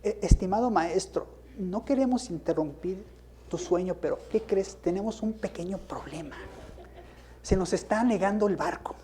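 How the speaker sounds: soft, mock-courteous request; gently ironic politeness; overly polite. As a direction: tiptoe around waking the master. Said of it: "¿Y cómo le habrán dicho?".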